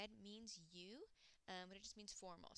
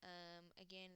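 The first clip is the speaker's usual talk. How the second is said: ordinary talk, nothing marked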